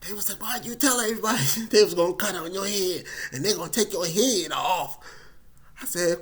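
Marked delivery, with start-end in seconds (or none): 0.4-5.0 fast high scolding question; family chewing him out; exasperated family. Retold or imitated. Family scolding him over the scare, exasperated.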